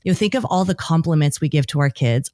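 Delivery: usual speaking voice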